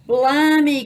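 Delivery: loud, high, drawn-out pirate cry. A narrator's reading. Pirate dismayed by rascal's fancy words, crying out. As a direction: burst out in pirate outrage. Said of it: "cried Pete".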